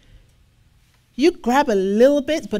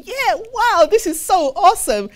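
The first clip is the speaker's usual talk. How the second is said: much higher, loud faked enthusiasm; mock excitement; 9 semitones higher, 6 dB louder than usual. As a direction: gush like a convert who won't show up. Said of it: "you think you're getting a connection".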